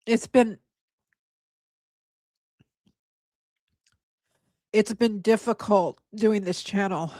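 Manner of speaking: halting, tearful admission; holding back tears. Real, not acted. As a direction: stop and restart, fighting back tears. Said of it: "I'm gonna cry".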